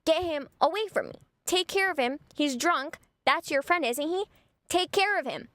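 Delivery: exasperated retold confrontation; indignant demand. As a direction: act out ordering his friend to step in. Acted angry confrontation with his friend.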